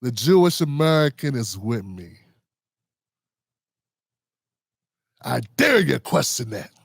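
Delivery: mock politician's challenge. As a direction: put on a deep, deliberate daring voice. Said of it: "He didn't say".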